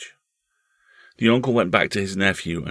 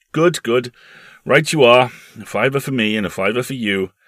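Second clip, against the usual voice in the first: loud, higher, approving voice; hearty uncle; 5 semitones higher, 8 dB louder than usual. Acted. Uncle settling a bet with his nephew, hearty. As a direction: agree with booming approval.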